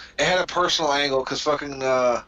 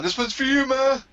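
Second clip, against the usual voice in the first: much higher, fast mock sincerity; mocking wrestler's tribute; 9 semitones higher, faster than usual. Wrestler pointing up to his dead mom, mock tribute. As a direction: sneer through a fake tribute to mom. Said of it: "he just pointed up to, for his mom".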